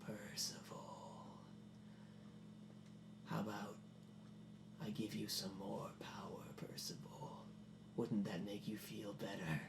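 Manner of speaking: low, soft, whispery villain voice; sinister tempter. Acted. Wardrobe creature tempts Percival with power, sinister whisper. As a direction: whisper it slowly, coaxing the victim.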